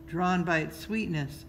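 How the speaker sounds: plain reading voice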